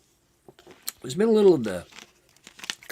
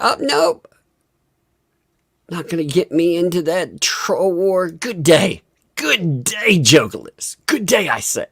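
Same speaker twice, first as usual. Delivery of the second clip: higher, louder pompous brush-off; mock dismissive farewell; 7 semitones higher, 5 dB louder than usual. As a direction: sign off grandly, haughty. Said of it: "Just tell her".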